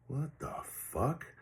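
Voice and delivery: high, hushed, drawn-out disbelief; inner disbelief. Retold or imitated. Storyteller replaying his silent reaction to the stranger's request, inner disbelief. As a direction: stretch it out softly, like a stunned private thought. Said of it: "in my mind, I'm like".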